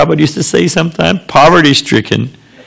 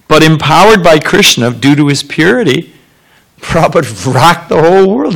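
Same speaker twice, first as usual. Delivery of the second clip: normal talking voice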